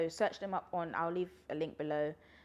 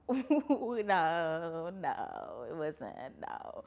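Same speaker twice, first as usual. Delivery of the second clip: laughing no; much slower than usual. Real, not acted. Laughing denial.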